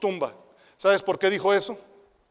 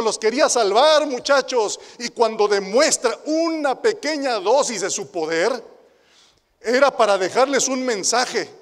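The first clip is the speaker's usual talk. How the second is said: higher, louder preaching; fervent preaching; 4 semitones higher, 6 dB louder than usual. Acted. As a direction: rise to the climax of the sermon. Preaching climax.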